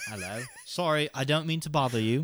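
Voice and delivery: put-on polite Londoner's British accent. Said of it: "Do it in the accent".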